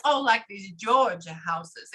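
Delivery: slow Georgia drawl; drawn-out Southern Georgia accent. Retold or imitated. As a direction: imitate a woman with a Georgia accent. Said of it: "from Georgia and she has that accent".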